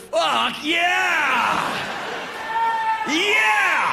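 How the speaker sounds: high, drawn-out mock rock shout; excited band cheer. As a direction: whoop it up like thrilled teenage metalheads. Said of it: "We were like".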